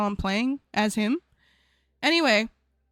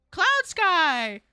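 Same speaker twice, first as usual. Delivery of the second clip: higher, excited name call-out; 5 semitones higher than usual. Real, not acted. Streamer greeting a new follower, calling out.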